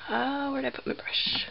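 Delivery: whispered aside while searching; muttered whisper to herself. Real, not acted.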